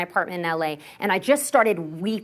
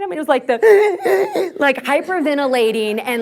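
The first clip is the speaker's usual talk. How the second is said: laughing; 5 semitones higher, 6 dB louder than usual. Real, not acted. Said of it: "it wasn't the cute cry".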